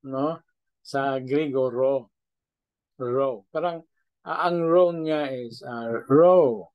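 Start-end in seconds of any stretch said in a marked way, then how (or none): 6.1-6.7 ro with a slight W glide, said louder; ro with a hint of W; rounded ro. Shown as a demonstration. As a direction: round the lips on ro like a W. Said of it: "na parang mayroong W".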